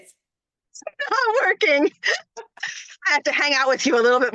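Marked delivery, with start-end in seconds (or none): 0.0-1.9 put-on a slow, half-hearted try at an Australian accent; a hint of Australian, slow and high; failed Australian accent. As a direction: drag it out in a weak Australian accent. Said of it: "Okay, so say it".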